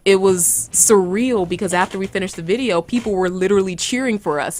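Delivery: usual speaking voice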